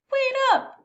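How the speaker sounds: high, loud call after someone. A narrator's reading. Beau chasing the red boot, loud high call. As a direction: shout it to make them stop. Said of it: "he called".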